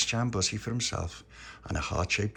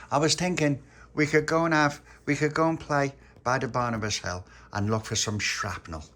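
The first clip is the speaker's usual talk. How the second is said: put-on raised, eager storybook child; lightly voiced Little Pappy, eager; 6 semitones higher, 5 dB louder than usual. A narrator's reading. Little Pappy inviting Pauline out to play, lightly voiced and eager. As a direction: pitch the plan keenly, starting high and loud.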